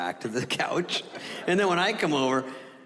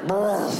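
fat dog bark; 7 semitones higher, 4 dB louder than usual. Retold or imitated. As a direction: do a fat little dog's bark. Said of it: "It's a fat bark".

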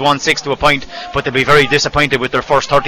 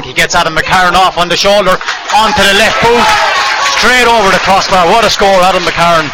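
excited; 4 semitones higher, 4 dB louder than usual. Real, not acted. Score called live in commentary.

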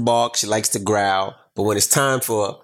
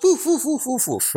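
chihuahua yapping; 12 semitones higher than usual. Retold or imitated. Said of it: "like chihuahuas".

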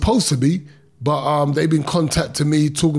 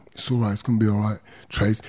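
softly reassuring; 6 semitones lower, 4 dB quieter, faster than usual. Retold or imitated. Calming wife in court.

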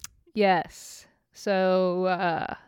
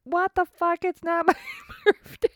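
shocked protest; 11 semitones higher than usual. Retold or imitated. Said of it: "I'm like".

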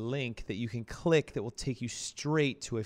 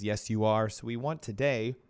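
usual speaking voice

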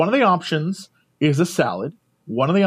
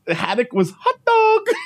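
incredulous; 8 semitones higher, slower than usual. Retold or imitated. Disbelief at the mishearing.

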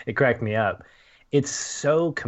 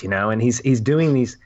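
usual speaking voice

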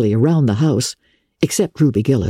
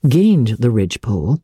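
plain reading voice

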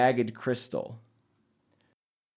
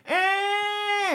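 high shrieking call back; creature cry. Acted. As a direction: answer with a high screech. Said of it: "Obsidian returns the".

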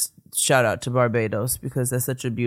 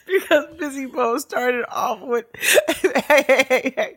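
high, laughing retelling; 10 semitones higher, 4 dB louder than usual. Real, not acted. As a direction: crack up while telling it.

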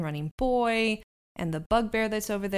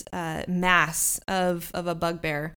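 normal talking voice

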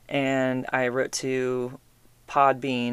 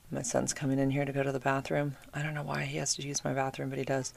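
quiet, dropping to a whisper; hushed aside; 8 dB quieter than usual. Real, not acted. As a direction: keep it down, someone just walked in. Son comes in, she goes quiet.

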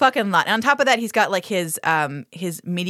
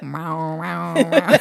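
mock meowing; 3 semitones lower, much slower than usual. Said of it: "imitated meow".

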